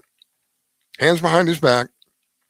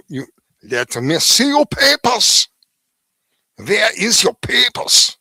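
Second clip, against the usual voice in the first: stern officer demanding papers; 6 semitones higher, 3 dB louder, slower than usual. Retold or imitated. Imitating Nazi officers demanding papers.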